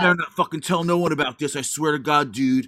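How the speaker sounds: mock-threatening straight dude; put-on straight-guy voice. Swearing the partner to secrecy in role play, put-on straight-guy voice. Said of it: "why do you sound like a Ninja Turtle".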